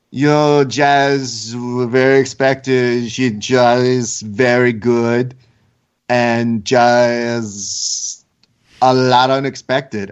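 slow, stretched imitation of a foreign accent; Valentina's accent. Host mimicking a fighter at her press conference, mock accent. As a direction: imitate her accent, dragging JUST into "jazz". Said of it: "at Valentina's accent".

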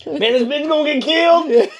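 slow, high shout, laughing; mock yell. Constant yeller whispering to a friend at the movies, yelling. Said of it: "try to whisper something".

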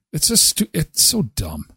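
ordinary talk

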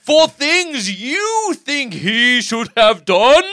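slow, loud, grand decree voice; mock pompous proclamation. Host mocking a commenter as a pompous lawgiver, grand slow proclamation. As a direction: declare it like a founding father reading a constitution.

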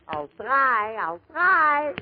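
put-on trying on an Irish accent, pitch climbing; attempted Irish brogue. Comic trying an Irish story out of his line, Irish accent. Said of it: "this is an Irish story".

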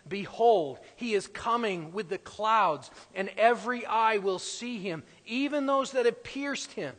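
loud, ringing proclamation of scripture; scripture reading. Acted. Preacher proclaiming the verse to the congregation, loud and ringing. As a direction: declaim the verse to the whole church.

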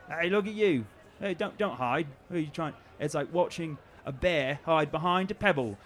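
high, loud sneering bully; mocking taunt. Guard taunts hiding Barb for the laughing crowd, high sneering mockery.

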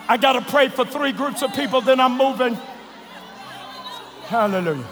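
low soft altar call; prayer voice. Acted.

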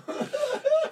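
a shrill-free high giggle burst; high burst of laughter. Retold or imitated. Telling the funeral story, bursting into high laughter. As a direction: laugh out high, unable to hold it.

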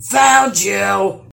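loud, gloating troll shout; triumphant holler. A narrator's reading. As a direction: holler it as the winning seeker. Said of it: "he hollered triumphantly".

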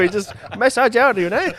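mock masseur. Mock massage guy reassuring mate.

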